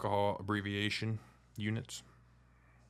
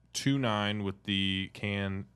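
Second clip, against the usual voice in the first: ordinary talk, nothing marked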